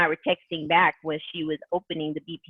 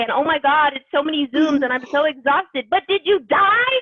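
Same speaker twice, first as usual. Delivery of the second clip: fired-up pep talk; 9 semitones higher, 5 dB louder than usual. Real, not acted.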